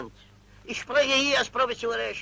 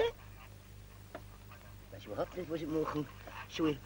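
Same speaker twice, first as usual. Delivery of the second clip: helpless murmur; 8 semitones lower, 14 dB quieter, slower than usual. Acted. Flustered official, at a loss.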